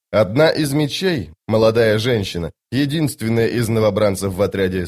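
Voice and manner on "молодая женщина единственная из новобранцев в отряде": ordinary delivery, nothing marked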